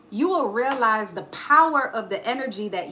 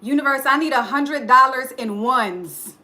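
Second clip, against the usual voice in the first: louder, firm declaration; a confident, louder ask; bold demand; 6 dB louder than usual. Shown as a demonstration. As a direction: tell the universe boldly what you want. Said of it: "That's not enough".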